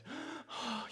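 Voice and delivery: high, sharp intake of breath; tearful gasp. Retold or imitated. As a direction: gasp as if about to cry. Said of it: "she almost started crying".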